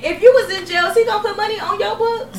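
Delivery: high, loud, disbelieving laugh; incredulous laughing. Real, not acted.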